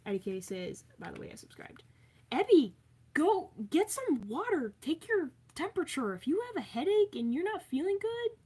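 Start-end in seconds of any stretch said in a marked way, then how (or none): 2.3-8.4 loud urging. Real, not acted. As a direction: call out to Ebby, firm and caring. Urging sick chatter to rest.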